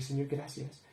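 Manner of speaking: soft, hushed "Señor, gracias"; quiet prayer. Retold or imitated. Alone in his room, thanking God in a soft prayer. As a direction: murmur a thank-you prayer.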